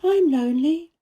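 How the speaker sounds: put-on young Mary voice. A narrator's reading. Mary's voice in the story.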